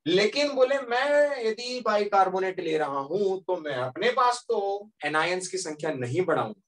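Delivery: ordinary talk, nothing marked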